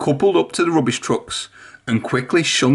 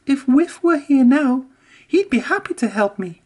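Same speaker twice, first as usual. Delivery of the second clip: high, regretful engine voice; sighing Emily; 13 semitones higher than usual. A narrator's reading. Emily misses Whiff, high and rueful. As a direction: wish it aloud, high and rueful. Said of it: "Emily sighed".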